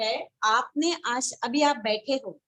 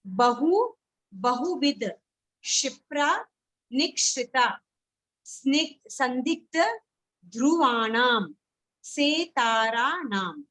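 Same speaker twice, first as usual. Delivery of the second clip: word by word, pausing after each; measured list recitation; slower than usual. Shown as a demonstration. Teacher naming the divisions for students, measured recitation. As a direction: recite each term separately, drawing out the last ones.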